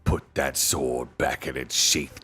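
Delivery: low, controlled menace; low warning. A narrator's reading. Dwarf telling the king to sheathe his sword, low threat. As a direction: warn him with the voice dropped.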